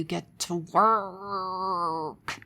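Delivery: hammed-up rage, hugely dragged-out "work"; mock enraged scream. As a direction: stretch "work" into an exaggerated angry wail. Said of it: "screaming, rageful, angry voice that would say".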